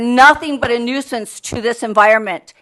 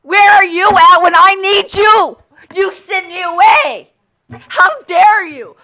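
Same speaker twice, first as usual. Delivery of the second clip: much higher and louder, accusing; furious shout; 6 semitones higher, 6 dB louder than usual. Real, not acted. Homeowner shouting at the council who sent her away, furious. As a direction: hurl it at them, outraged.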